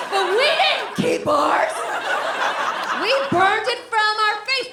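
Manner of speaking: high, loud rising rant; loud high comic rant. Real, not acted. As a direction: build the rant to a peak. Comic rant about women.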